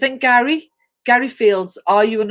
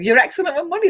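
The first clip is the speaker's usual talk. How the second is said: ordinary delivery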